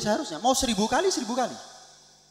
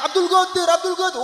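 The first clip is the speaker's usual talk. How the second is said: high, loud acted holler; mock alarm call, pitched way up; neighbour's yelling; 11 semitones higher, 5 dB louder than usual. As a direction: shout the name across to a neighbour. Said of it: "teriak-teriak".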